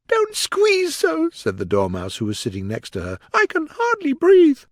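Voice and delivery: high, squeaky little rodent; squeaky Dormouse voice. Acted. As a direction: squeak it out like a tiny mouse.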